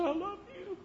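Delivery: a dying man barely calling out; weak little voice. Retold or imitated. Dying friend on the phone from hospice, frail and high. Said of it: "this little voice".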